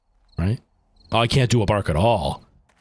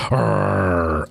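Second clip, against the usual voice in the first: long human "arr" dog growl; mock dog growl. Shown as a demonstration. Streamer showing how people imitate dogs, mock growl. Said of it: "people growling like dogs".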